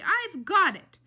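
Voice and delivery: high, very loud announcement; Tootie taking charge. A narrator's reading. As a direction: call out that you'll handle it. Tootie takes charge of the chaos.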